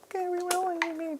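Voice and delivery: high, slow sarcastic squeak; mocking falsetto. Mocking opponent's reroll.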